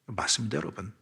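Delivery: whispered affirmation to the congregation; softly whispered agreement. Real, not acted. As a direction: drop to a whisper, intimate. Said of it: "whispered aside to congregation".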